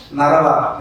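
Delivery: Narava said as three distinct beats, Na-ra-va; Narava as syllables. Shown as a demonstration. Showing how shifted syllables change the word, Narava in distinct beats. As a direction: lay out Narava beat by beat. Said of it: "just by shifting the syllables".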